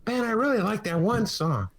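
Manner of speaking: high put-on voice of an enthusiastic fan. Music fan praises a favorite song, high imitation. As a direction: pitch it up, mimicking a friend. Voicing [name], who loves Lil Wayne.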